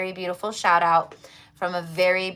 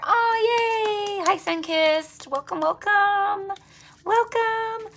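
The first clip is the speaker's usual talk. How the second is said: high, slow sing-song welcome; excited greeting; 14 semitones higher, much slower than usual. Real, not acted. Greeting a newcomer in the live chat, high sing-song welcome.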